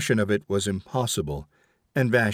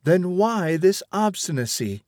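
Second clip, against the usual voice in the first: higher, insistent mother arguing; stern mother; 6 semitones higher than usual. Acted. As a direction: press the point like a scolding mother. Vashti keeps arguing.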